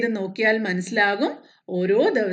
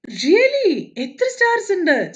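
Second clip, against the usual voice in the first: much higher and louder, astonished; big surprise; 8 semitones higher, 4 dB louder than usual. Acted. Moon asking the sun in Malayalam, astonished. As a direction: gasp it out in wonder. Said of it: "ചന്ദമാമയ്ക്ക് ആകെ surprise ആയി".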